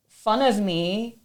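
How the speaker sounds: usual speaking voice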